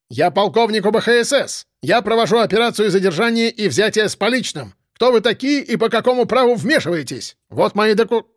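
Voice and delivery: high, indignant official declaration; angry protest. A narrator's reading. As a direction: protest with rank, pitch climbing. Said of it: "гневно воскликнул Ананас".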